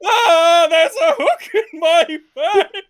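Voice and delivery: high, laughing panic; panicked fish voice. Acted. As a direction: wail it as if hooked. Frank hooked, panicking.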